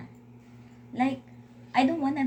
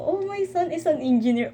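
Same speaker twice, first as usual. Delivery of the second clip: proud parent; 9 semitones higher, 4 dB louder than usual. Pretend. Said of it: "oh my God".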